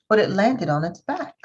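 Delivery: ordinary delivery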